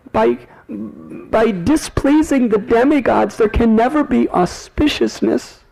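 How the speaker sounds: high, fretful mother's scolding; scolding mother Yashoda. Acted. Yashoda scolds her restless son, high worried voice.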